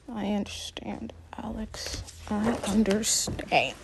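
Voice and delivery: breathy whispered mother; soft sympathetic whisper; mother murmuring understanding. A narrator's reading.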